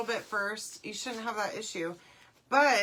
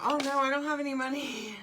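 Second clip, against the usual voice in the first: mock freak-out; 4 semitones higher than usual. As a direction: imitate someone fretting in a higher voice. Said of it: "freaking out, like".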